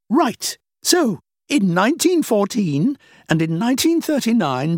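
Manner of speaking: a hint of a dismissive older woman; lightly played older woman, amused. The woman argues her point.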